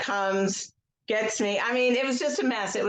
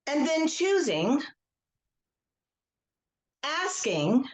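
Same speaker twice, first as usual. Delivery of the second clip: slow guided script with long pauses; guided tapping script. Acted. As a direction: lead the group gently, line by line.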